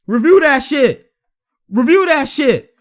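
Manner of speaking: high, loud yell at the refs; indignant fan shout; shouted demand. Real, not acted. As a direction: holler for a review. Demands review of foul call.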